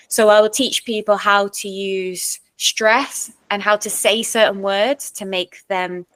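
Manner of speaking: ordinary talk, nothing marked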